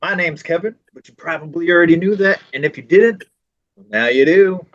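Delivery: hyped show-opener greeting; podcast intro. Shown as a demonstration. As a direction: kick off the show like a host. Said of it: "I'm just like".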